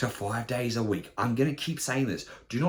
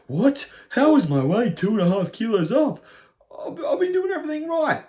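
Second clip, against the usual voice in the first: high-pitched, incredulous whine; mock outrage; 13 semitones higher, 5 dB louder than usual. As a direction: act appalled at the scale, voice shooting up. Acting out shock at the scale.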